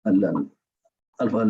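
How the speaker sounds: ordinary talk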